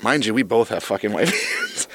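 normal talking voice

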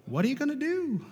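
high, eerie tire voice repeating the question; talking tire. Retold or imitated. Preacher voicing a tire that taunts him, high and eerie. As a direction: pitch it way up like a nagging tire. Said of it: "That front left tire started talking to me".

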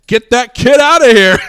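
high, loud heckler's shout; imitated yell. Dude in the front yelling at the dad, mock outraged shout. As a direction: holler it across a theater, half laughing. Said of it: "of yelling at the guy".

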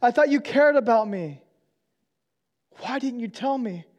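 hurt and betrayed. Retold or imitated. Betrayed coworker pleading with his friend, high and hurt. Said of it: "He says".

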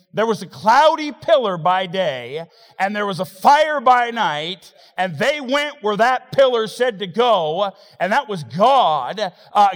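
loud, high, driving sermon delivery; preaching at full force. Real, not acted. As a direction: preach it hard to the congregation.

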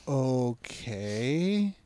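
long, drawn-out okay, a bit quiet; confused. Retold or imitated. Driver reacting to the prison warning sign, confused. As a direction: stretch it out, unsure what this means. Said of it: "I'm super confused".

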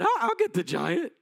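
put-on cocky kid volunteering; cracking teen voice. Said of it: "Probably got a voice that cracks".

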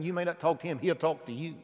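usual speaking voice

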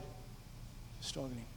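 hushed, slow, breathless whisper. Retold or imitated. Telling the church the horse was tiring, hushed whisper. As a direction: let the words sag to a whisper.

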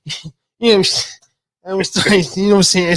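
high-pitched, excited impression, stumbling over phrases; Mike Tyson. Podcaster joking to his friend about sounding excitable, in a high Mike Tyson impression. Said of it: "start talking like Mike Tyson".

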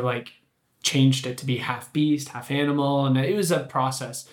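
ordinary delivery

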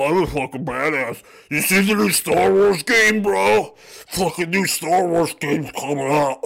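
loud, dopey slowed caricature; oblivious dumb bro. Acted. Zombie sheep boasting he's a rebel, dopey mockery. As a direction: play a lumbering self-styled rebel. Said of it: "they think you're that thing. Like".

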